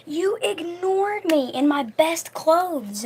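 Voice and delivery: high, drawn-out imitated whine; mock crying. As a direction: imitate a wounded, tearful complaint.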